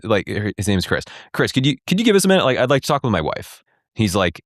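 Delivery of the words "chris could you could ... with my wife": rushed, higher retold request; hurried plea. Husband hurriedly asking father-in-law to leave, fast and high. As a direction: blurt it out fast and pleading.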